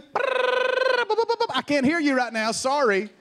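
high fast taunting babble; mocking stammer. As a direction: brush off the thoughts with babbling. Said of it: "what do you do?".

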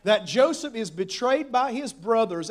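normal talking voice

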